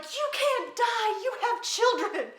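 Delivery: high, loud, slow desperate plea; desperate pleading. Retold or imitated. Friend slapping her awake, high desperate plea. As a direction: beg her not to die. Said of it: "she's like".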